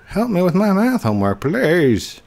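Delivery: high, whiny plea for help; pleading kid. Acted. Player voices a viewer begging for homework help, high and pleading. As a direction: beg in a put-on high voice.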